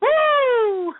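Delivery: high, loud whoop; triumphant high whoop; gleeful whoop. Real, not acted. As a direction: let out a high celebratory woo. Celebrates riling the caller.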